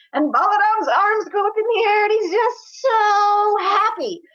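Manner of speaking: very high, loud, squealing tattletale; gleeful yelling. Acted. Boy crowing over brother's coming trouble, gleeful yelling. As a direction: explode with triumph, words stretched. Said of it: "he yells that at his brother".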